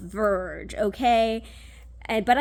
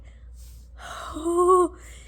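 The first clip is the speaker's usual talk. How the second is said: long, voiced sigh, pitched up; high, exhausted exhale; overwrought sigh; 7 semitones higher than usual. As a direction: heave a big, drawn-out sigh. Sigh after telling of crying.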